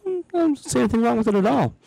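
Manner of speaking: breezy, fast shrug-off; nonchalant. Retold or imitated. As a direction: toss it off like nothing happened. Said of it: "very nonchalantly".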